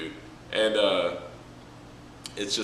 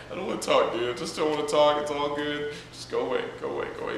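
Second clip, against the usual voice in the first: mopey brush-off; 6 semitones higher, faster than usual. Retold or imitated. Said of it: "Hashirama's like".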